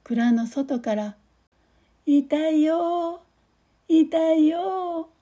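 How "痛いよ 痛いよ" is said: slow, high, drawn-out whimpering; crying. Acted. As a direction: wail each word slowly and high. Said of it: "クスノキの泣き声".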